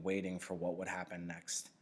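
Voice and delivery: ordinary delivery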